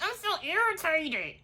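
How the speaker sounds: playful high, soft impression; Kandi. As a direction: squeak out mock irritation quietly. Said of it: "that's my Kandi voice".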